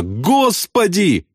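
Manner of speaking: very high, stretched wail; long high cry to God; piercing lament. A narrator's reading. Airman watching flak bursts, wailing piercingly. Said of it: "пронзительно причитал он".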